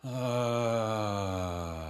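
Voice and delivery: full open ah on the exhale; loud voiced sigh. Shown as a demonstration. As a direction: release a loud sung-out ah. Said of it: "We're just sighing".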